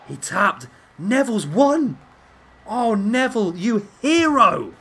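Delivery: loud, high, slow triumphant yell; ecstatic celebrating. Real, not acted. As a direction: celebrate the win at full voice. Wrestler wins, celebrating.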